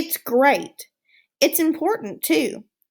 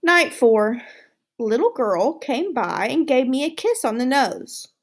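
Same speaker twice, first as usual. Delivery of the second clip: usual speaking voice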